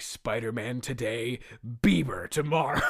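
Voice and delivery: slow, mock-menacing villain vow; drawn-out hammed-up declaration of vengeance; seething Doctor Octopus. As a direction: savour it like a plotting supervillain. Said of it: "such a seething rage".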